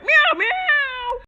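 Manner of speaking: high crying meows; kitty meow. Pretend. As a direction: mew like a scared kitten. Kitty toy voiced.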